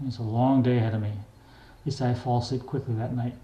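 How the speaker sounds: usual speaking voice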